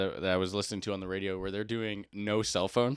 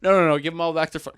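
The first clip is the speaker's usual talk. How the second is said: high, loud imitation of refusing teachers; emphatic acted protest; mock outraged refusal; 5 semitones higher, 7 dB louder, faster than usual. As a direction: refuse loudly as the indignant teachers.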